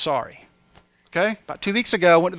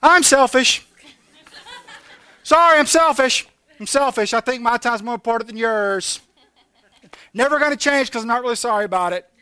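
higher, loud, blithe confession; mock apology; 6 semitones higher, 6 dB louder than usual. Late friend getting out of car, mock-apologetic. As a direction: call it out like a breezy apology. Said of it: "What you need to tell them to start saying".

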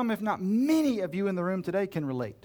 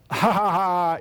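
loud fake ha ha ha; mocking laugh; 6 dB louder, slower than usual. Friends mocking the magus's idea, a loud scornful laugh. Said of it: "they're like".

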